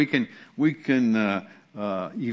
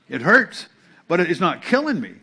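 ordinary delivery

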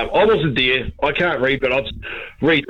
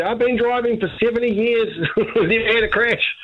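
put-on higher, laughing elderly mum voice; old mum; 9 semitones higher than usual. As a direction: play his late mum, proud of her driving. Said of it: "and she'd say".

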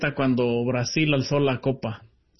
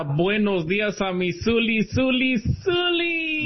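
sing-song greeting climbing higher; playful drawn-out hello, rising at the end; exaggerated greeting; 5 semitones higher, slower than usual. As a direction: stretch it out, ending way up high.

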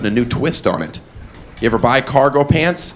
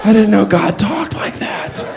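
high, slow startled voice; awed little man; 9 semitones higher, slower than usual. Retold or imitated. Comic as himself meeting God, high and amazed. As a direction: gasp it out in wonder. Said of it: "He'd be like".

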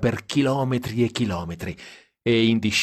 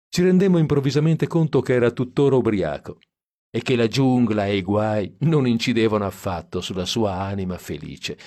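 ordinary delivery, nothing marked

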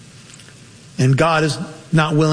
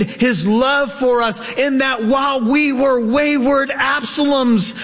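higher, impassioned sermon voice; full-force preaching; 7 semitones higher than usual. Acted. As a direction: preach it out with rising fervour.

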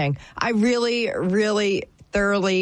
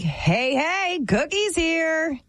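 drawn-out, rising singsong call; playful slow hail on walking in; mock cheery announcement; 7 semitones higher, much slower than usual. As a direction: announce yourself to the room, stretched high. Imagines walking into the bar.

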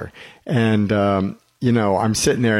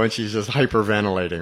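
ordinary talk

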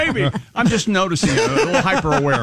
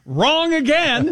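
high, drawn-out "told you so" call; mocking catchphrase; 12 semitones higher, much slower than usual. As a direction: stretch it out high, gloating in jest. Keeps repeating a mocking catchphrase.

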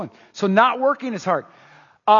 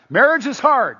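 Marriage is hard thrown out higher and louder; louder repeat; 6 semitones higher, 7 dB louder than usual. Shown as a demonstration. Pastor repeating the line louder to grinning spouses. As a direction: punch it back at the laughing couples. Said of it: "I wanna emphasize".

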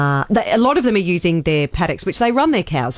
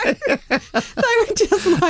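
high, slow, squeezed out through laughter; laughing through words; 13 semitones higher, much slower than usual. Real, not acted. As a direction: keep laughing as you say it. Laughing while telling funny story.

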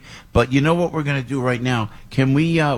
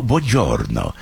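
Italian greeting; 9 semitones lower, slower than usual. Shown as a demonstration. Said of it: "the bu".

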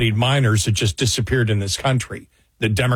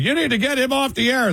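high quoted voice; 12 semitones higher than usual. Retold or imitated. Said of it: "But it was like".

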